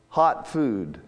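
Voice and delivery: usual speaking voice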